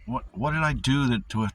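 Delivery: ordinary delivery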